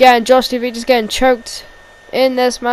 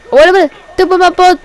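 loud, higher surprised move calls; excited play-by-play; 7 semitones higher, 8 dB louder, much faster than usual. Real, not acted. Commentator calling a Superman punch, excited shout. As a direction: call the punch with a jolt.

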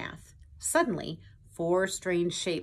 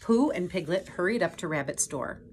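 ordinary delivery

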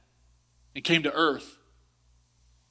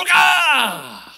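triumphant shout; 9 semitones higher, 9 dB louder than usual. Retold or imitated. Spiking the ball, shouting.

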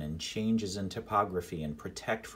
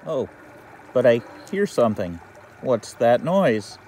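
nervous and alarmed; 13 dB louder than usual. Acted. Hears noise in the cave.

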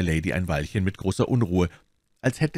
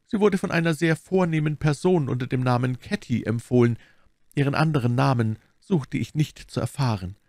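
lightly voiced abbess, a bit raised; explaining abbess; 7 semitones higher than usual. A narrator's reading. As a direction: inform the guest in a polite, lifted voice.

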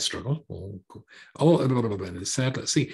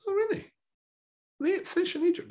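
much higher, sarcastic "oh really?"; sarcastic disbelief, pitched way up; mock surprise. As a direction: feign amazement to mock them.

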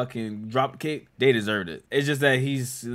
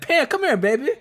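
squeaky high mock voice; high mock imitation of the character; 14 semitones higher, 4 dB louder than usual. Reacting to a horror movie, mimicking the man calling Pam, high and mocking. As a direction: parrot his line back, pitched way up and laughing. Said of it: "He said".

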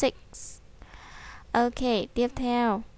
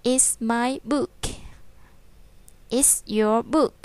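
slow read-aloud; much slower than usual. Shown as a demonstration. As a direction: read it back slowly. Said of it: "Đọc lại nhé".

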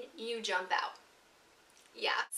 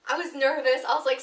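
usual speaking voice

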